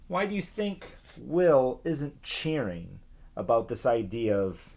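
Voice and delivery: usual speaking voice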